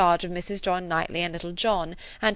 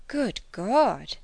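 Good God! in a shifted, startled voice; startled cry; 3 semitones higher than usual. A narrator's reading. Emma hearing Harriet will marry Martin, startled cry. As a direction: blurt it out in shock. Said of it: "she cried".

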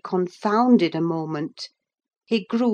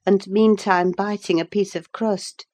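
ordinary delivery

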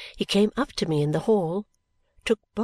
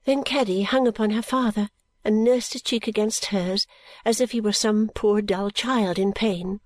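usual speaking voice

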